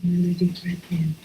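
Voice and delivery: gentle meditation lead; guided breathing. Acted. Leads a breathing exercise.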